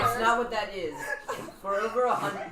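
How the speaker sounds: breaking up laughing mid-read. Real, not acted. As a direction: try to read through laughter. Reading through laughter.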